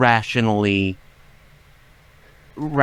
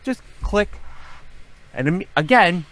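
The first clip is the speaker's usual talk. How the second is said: ordinary talk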